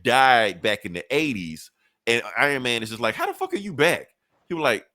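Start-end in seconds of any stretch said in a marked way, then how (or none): 3.1-4.1 high-pitched mock astonishment; incredulous. Iron Man facing a villain back from the dead, incredulous. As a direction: act out baffled disbelief.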